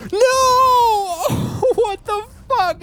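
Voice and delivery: high, loud wail of disbelief; anguished scream. Real, not acted. Player whose lightsaber failed in a boss fight, anguished scream.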